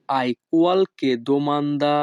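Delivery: each Italian word spoken slowly and clearly; teacher modelling Italian for learners, very slow; slow demo. Shown as a demonstration. Bengali teacher models Italian phrase for students, slow and careful. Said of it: "Italian ভাষা".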